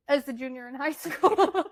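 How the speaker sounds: laughing through the words; amused teasing. Real, not acted. Sister teasing her about chain smoking, laughing. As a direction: tease with a laugh breaking in.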